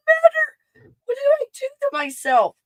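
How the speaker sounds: overwhelmed and half laughing at herself; frantic excitement. Real, not acted. Panicking happily about the mint, frantic and high.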